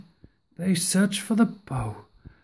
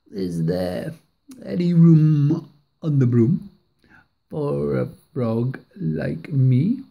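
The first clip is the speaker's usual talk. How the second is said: croaking frog; 5 semitones lower than usual. A narrator's reading. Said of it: "said with a croak".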